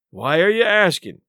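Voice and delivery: lightly voiced stern challenge; frowning. A narrator's reading. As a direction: demand it with a frown. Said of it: "with a frown".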